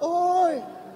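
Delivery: high hollering call across a ravine; imitated kid yelling into the distance; child's shout. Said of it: "dia berteriak".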